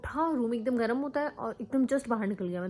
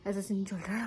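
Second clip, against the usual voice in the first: whisper; 4 dB quieter than usual. Real, not acted. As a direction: whisper the update softly. Whispering near sleeping child.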